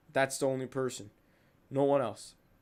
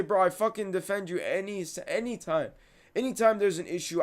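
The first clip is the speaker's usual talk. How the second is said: raised, higher voice, aggrieved; indignant reproach; 6 semitones higher than usual. Acted. Telling off a friend you always defend, indignant. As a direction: press the point at a disloyal friend. Retold confrontation over loyalty.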